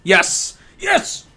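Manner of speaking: triumphant yes, jumping up high; excited cheer. Real, not acted. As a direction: celebrate getting it right. Celebrating guessing the capital.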